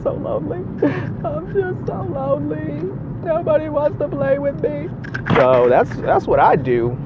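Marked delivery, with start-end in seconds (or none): 0.0-4.9 high, whiny mock lonely voice; mock self-pity. Jokingly pitying himself over solo card games, whiny. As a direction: pout about having nobody to play with.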